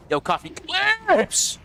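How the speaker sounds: high, long-stretched greeting call; playful, elongated name call; excited shout-out. Real, not acted. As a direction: hail them with a drawn-out whoop. Hailing a new follower by name.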